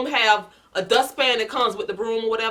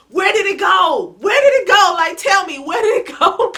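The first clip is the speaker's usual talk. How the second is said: loud and higher, demanding again and again; frantic yelling; 7 semitones higher, 5 dB louder than usual. Retold or imitated. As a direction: shake the answer out of the kids. Shaking sons, demanding answers.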